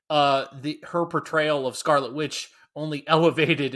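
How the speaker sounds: ordinary delivery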